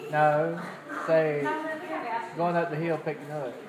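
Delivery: usual speaking voice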